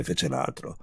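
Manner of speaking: ordinary delivery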